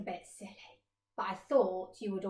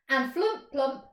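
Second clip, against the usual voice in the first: ordinary delivery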